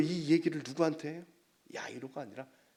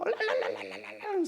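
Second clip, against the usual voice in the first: mourners' ululation, imitated; imitated wailing. Preacher imitates Middle Eastern women mourning, imitated wailing. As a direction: rattle off a rolling-tongue wail. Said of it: "소리를 내".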